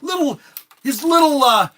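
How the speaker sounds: high and rising with real glee; the seller gets carried away describing the dream, high and loud; excited. Real, not acted. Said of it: "I'm getting excited myself".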